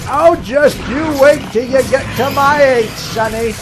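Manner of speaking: put-on grumpy old man. Grumbling at a youngster, grumpy old man voice. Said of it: "the grumpy old man going".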